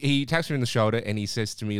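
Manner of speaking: ordinary talk, nothing marked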